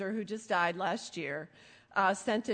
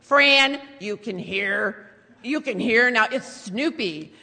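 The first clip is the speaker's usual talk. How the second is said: emphatic, louder on name and hearing; insistent mock dad; 2 semitones higher, 8 dB louder, slower than usual. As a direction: press each point on a poor listener. Dad insists to mom who mishears.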